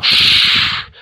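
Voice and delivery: loud unvoiced hiss; cat hiss. A narrator's reading. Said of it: "зашипела". (кошачье шипение)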